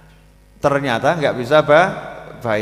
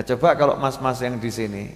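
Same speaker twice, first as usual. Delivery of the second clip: ordinary delivery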